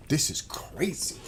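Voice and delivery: slow, breathy whisper of disbelief; a stunned whisper to the viewers; whispered aside. Real, not acted. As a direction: drop to a hushed whisper, slowly. Aside after ranting about prices.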